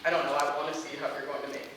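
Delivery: ordinary delivery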